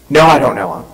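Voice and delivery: mild, firm denial in a falling tone. Acted. Peter to those questioning him about Jesus, firm denial. Peter denies again when pressed.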